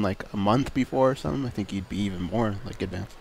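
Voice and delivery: ordinary talk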